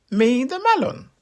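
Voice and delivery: very high, chirpy and loud; high squeaky melon greeting; tiny melon voice. Acted. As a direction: chirp politely like a talking melon. Anansi as melon.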